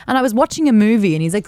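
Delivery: ordinary talk, nothing marked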